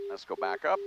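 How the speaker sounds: ordinary talk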